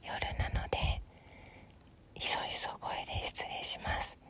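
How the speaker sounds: soft, unvoiced whispered greeting; hushed whisper. Real, not acted. Podcaster opening a late-night show, excusing the hour, hushed whisper. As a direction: whisper it, keeping your voice right down.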